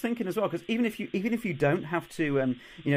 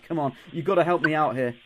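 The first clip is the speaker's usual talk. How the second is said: ordinary delivery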